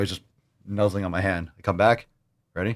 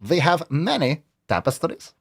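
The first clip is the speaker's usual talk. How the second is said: a higher take on the tapestry line; imitating the clip; 5 semitones higher than usual. Host copying the played clip, voice raised. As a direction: echo the ad voice closely. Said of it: "Pretty good".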